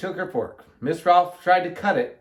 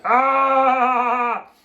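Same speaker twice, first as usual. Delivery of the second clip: high, loud, drawn-out "ah"; mock laugh; 8 semitones higher, 6 dB louder than usual. A narrator's reading. Guests laughing as they leave, loud mock laugh. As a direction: let out one long fake laugh. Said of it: "they were still laughing when they left".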